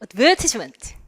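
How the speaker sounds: "advertisement" as ad-VER-tis-ment; British "advertisement" with short "tis"; British pronunciation. Shown as a demonstration. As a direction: stress VER in "advertisement", crisp t. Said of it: "세 가지 발음이 있겠죠".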